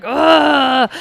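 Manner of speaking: loud imitated bearing-down groan; long mock grunt of effort; straining push grunt. Teller mimics woman pushing in labor, loud straining grunt. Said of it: "the lady pushes".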